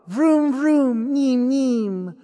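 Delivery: high playful vroom sounds; truck noises. Acted. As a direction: make toy engine noises like a kid. Boy playing with toy truck.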